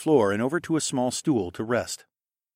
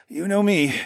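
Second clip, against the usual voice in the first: strained, higher-pitched grunt; effortful, louder reply; 10 semitones higher, 5 dB louder than usual. A narrator's reading. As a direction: grunt it out through the discomfort. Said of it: "he grunted".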